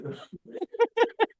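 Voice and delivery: a short, very high giggle; high amused giggle. Acted. Yashoda laughs at the child.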